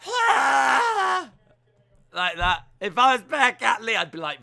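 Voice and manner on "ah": high, loud feeble roar; hammed-up weak roar; rubbish roar. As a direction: do a deliberately bad roar. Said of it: "I want him to go".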